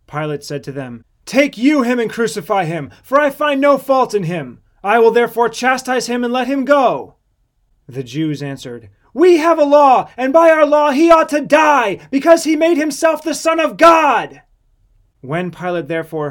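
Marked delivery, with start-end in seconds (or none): 1.3-7.2 loud, high, declamatory governor; raised voice, firmly refusing; forceful protest. A narrator's reading. Pilate argues with the shouting crowd. 9.1-14.4 high, very loud outraged mob; full-voiced, heated public accusation; shouted accusation. A narrator's reading. Accusers shout back at Pilate.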